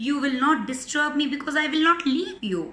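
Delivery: high, loud, rapid refusal; indignant lion. A narrator's reading. Lion refusing to let the mouse go, indignant. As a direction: rattle off a cross refusal.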